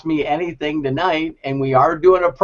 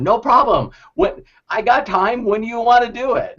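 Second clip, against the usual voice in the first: Susan's eager yes; 8 semitones higher than usual. Retold or imitated. Voices Susan's eager reply.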